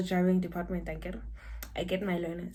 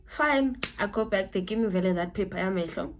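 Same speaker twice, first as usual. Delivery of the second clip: usual speaking voice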